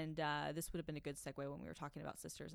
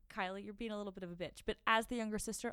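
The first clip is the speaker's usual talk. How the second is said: ordinary delivery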